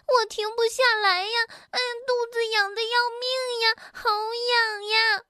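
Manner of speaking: high, wailing itchy whine; flustered, itching. Acted. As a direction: wail it high, drawing out the end. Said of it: "蹦蹦慌乱地挣扎着". (慌乱地高声喊痒)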